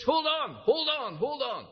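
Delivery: high urgent shout, fading down. Retold or imitated. Boy shouting at the teacher to hold on. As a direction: cry out to halt the cane. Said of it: "Big Jim shouts".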